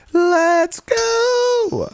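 highest, loud stretched cheer; all-out victory cheer; peak hype cheer. Real, not acted. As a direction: scream it to the rafters. Peak of the celebration.

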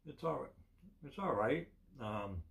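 eating chocolate while speaking; talking while chewing. Real, not acted. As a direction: mouth partly full of candy. Seen 0: Capped man bit Kit Kat at -9, jaw working while talking.

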